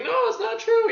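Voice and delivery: very high, loud imitation of a desperate woman; mock wailing protest. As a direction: mimic her screeching denial. Mimics woman protesting in the film.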